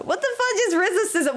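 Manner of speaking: fast, high incredulous question; baffled disbelief. Real, not acted. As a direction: blurt it out in real bafflement. Baffled by odd word from chat.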